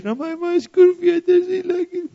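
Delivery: put-on high, slow little boy's whine; whiny small child. Son complaining to dad about shoelaces, high child whine. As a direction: whimper it like a fussy five-year-old. Five-year-old son whining about laces.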